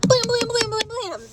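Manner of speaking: ordinary delivery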